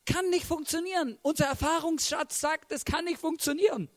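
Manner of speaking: high-pitched, incredulous protest; disbelieving disciples. Acted. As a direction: object as if it is absurd. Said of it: "weil die Jünger sagen".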